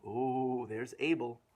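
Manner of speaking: ordinary delivery, nothing marked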